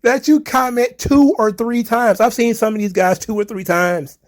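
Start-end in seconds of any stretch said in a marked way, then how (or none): 0.0-2.2 incredulous. Real, not acted. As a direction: raise it up in amazed disbelief. Incredulous at angry commenters.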